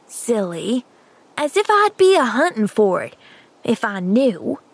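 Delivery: lightly voiced scornful boy, sneering at a silly question. Boy scolds Pollyanna for a silly question, scornful.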